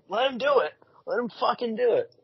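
mock fan demand. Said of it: "everyone was like".

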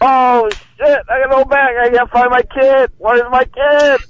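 high-pitched pretend-frantic call; mock panicked yelling. Prankster pretends his kid is lost, mock panicked yelling. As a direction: cry out like a parent faking a lost child. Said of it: "Just start yelling".

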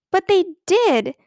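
slow, loud, high furious cry; a girl crying out at her friend in fury. A narrator's reading. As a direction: drag it out in a rage. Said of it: "cried Ramona, burning with fury".

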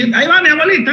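high, playful announcement; mock announcement. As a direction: call it out like tossing her over. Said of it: "así".

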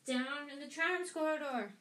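gleeful poltergeist's holler; bellowed. A narrator's reading. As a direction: call out the alarm in a high voice. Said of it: "Peeves bellowed".

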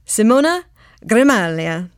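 a playful attempt at Italian vowels on Simona Gramaglia; best Italian accent. Shown as a demonstration. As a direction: give the name its full Italian lilt. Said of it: "That is my best Italian accent".

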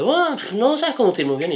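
high-pitched mock instructor voice; put-on high voice of a village teacher; country driving instructor. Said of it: "es como muy de pueblo".

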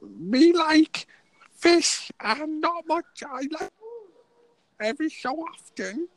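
very high, slow squeaky joke voice; put-on voice. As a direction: squeak it out like a silly cartoon. Continues the silly put-on voice.

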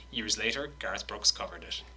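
ordinary talk

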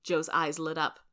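ordinary delivery, nothing marked

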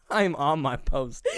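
slow, drawn-out "I'm on my post"; "I'm on my post" said slowly and deliberately; emphatic retort. Pretend. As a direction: stretch out every word of "I'm on my post" to insist.

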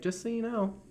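very high, sugary sing-song; sweet sing-songy voice. Retold or imitated. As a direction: chirp it like an innocent lady. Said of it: "she's just like".

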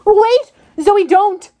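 loud, high cry to stop her; urgent shout. Acted. Percy shouts at Zoe not to shoot, urgent shout. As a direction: yell it to stop the shot.

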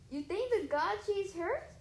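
high, wondering question; confused and a little loud; puzzled. Acted. Tyson confused about Annabeth, puzzled question. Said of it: "he asked, puzzled".

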